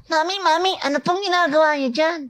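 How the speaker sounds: put-on very high, converted child voice; tiny, very high-pitched child; processed kid voice. Shown as a demonstration. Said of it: "pag-process niyan".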